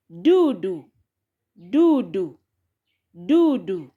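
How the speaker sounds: dudu said du-du, each syllable even; Swahili syllables. Shown as a demonstration. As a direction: model dudu clearly for learners. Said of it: "If you combine syllable du".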